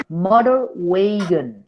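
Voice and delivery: Motor wagon said slowly, MOtor stressed; teacher models Motor wagon for the student to copy; slow model phrase. Shown as a demonstration. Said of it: "Así, literal, léelo así".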